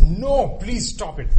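loud pleading cry of conscience; inner voice pleading. Retold or imitated. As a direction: call out no, begging him to stop. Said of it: "it says".

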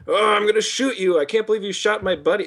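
high-pitched, hammed-up outrage; mock anger. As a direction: imitate a hero's vengeful rant, unconvincingly. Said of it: "he grabs a gun and he's like".

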